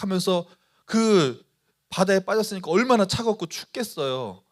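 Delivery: normal talking voice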